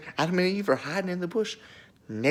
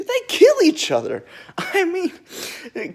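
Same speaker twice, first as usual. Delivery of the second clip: much higher and louder, bursting into laughter; laughing; 12 semitones higher, 5 dB louder, slower than usual. Real, not acted. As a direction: crack up while delivering the punchline. Laughs at own dark joke.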